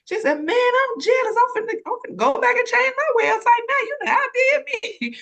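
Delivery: high, loud, laughing amazement; jealous excited friend. Retold or imitated. Friend raving about the website, laughing and jealous. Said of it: "my friend, she was like".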